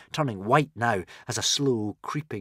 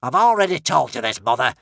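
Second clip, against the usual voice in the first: impatient; 4 semitones higher, 6 dB louder than usual. A narrator's reading. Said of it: "said Alvin impatiently".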